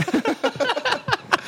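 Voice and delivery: very high rising laugh; real laugh. Real, not acted. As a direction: break into squealing laughter. Laughing at joke.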